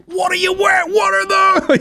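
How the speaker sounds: high, loud mocking shout; high loud screeching mock shout. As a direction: screech it like an outraged celebrity. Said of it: "he flew to Paris like".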